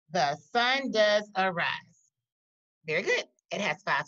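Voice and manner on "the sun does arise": slow, louder, each syllable counted out; teacher reading a poem line for counting syllables, slow and clear; slow read-aloud. Shown as a demonstration. Teacher reads the line to the class, slowly and clearly. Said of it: "Let's read it".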